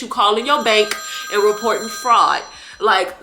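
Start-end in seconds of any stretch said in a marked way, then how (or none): none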